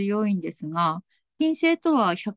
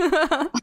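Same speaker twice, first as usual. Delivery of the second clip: short laugh; 6 semitones higher than usual. Real, not acted. Guest laughs, reassured.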